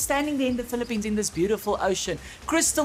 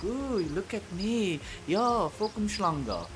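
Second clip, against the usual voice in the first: slow, softer smug show-off voice; arrogant tourist; 2 semitones higher, 6 dB quieter, slower than usual. Retold or imitated. South African mocks arrogant tourists abroad, smug and slow. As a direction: preen like a snob abroad. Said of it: "We think".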